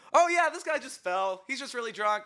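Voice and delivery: high, over-innocent reassurance; casual cover story. Retold or imitated. Covering story told to cop.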